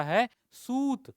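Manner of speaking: सूत drawn out slowly with a long high ऊ; long vowel stretched. Shown as a demonstration. Teacher to class, drawing out the long ऊ of सूत. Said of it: "शब्द है सुत और दूसरा".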